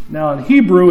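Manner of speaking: ordinary talk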